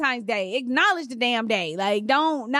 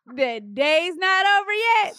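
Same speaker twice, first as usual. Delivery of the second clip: high, stretched, climbing mock protest; 7 semitones higher than usual. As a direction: mimic the husband's excuse, dragging it out. Repeats husband's excuse, drawn out.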